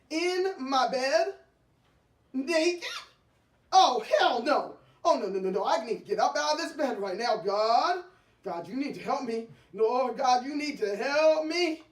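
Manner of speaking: loud, rushed desperate yelling; frantic shouting. Acted. Wife praying to get out of bed, frantic loud yelling. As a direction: plead to God, frantic and loud.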